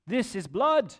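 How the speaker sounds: lightly put-on mystery accent for the Moabite army; improvised odd accent. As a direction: voice the soldiers in a made-up foreign accent. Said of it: "I don't know what this accent is".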